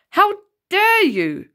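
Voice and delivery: slow, loud, high teacher's shout; enraged teacher stopping a fight; furious shout. A narrator's reading. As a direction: bellow it at the two boys. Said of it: "No one had ever seen her looking so angry".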